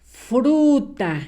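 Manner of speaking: drawn-out dictation, word by word; dictating. Shown as a demonstration. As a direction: stretch the word as you write it. Dicta mientras escribe. (dictando, alargando la palabra)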